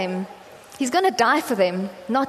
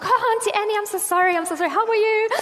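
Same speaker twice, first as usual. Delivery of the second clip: high, flustered cringing apology; embarrassed apology; 9 semitones higher, faster than usual. Retold or imitated. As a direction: squeak out an embarrassed sorry. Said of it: "I went into full-blown cringe fest".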